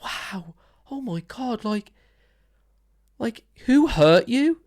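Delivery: hushed, stunned, then rising; mock-moved fan reacting; mock awed listener. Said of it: "we're just there like".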